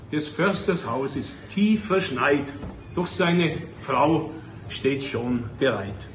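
poem recitation. Acted. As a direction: drop to a hushed storyteller's tone.

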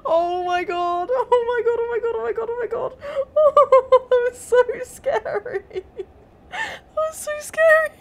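high screaming, laughing and crying; shrieking jackpot winner. Real, not acted. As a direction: hysterical terrified shrieks. Jump scare screaming.

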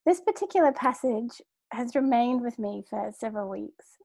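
normal talking voice

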